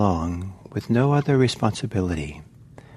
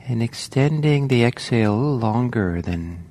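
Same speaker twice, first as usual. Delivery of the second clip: guided meditation. Acted. Teacher guiding the group into the sitting, calm meditation voice. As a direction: settle them into the body gently.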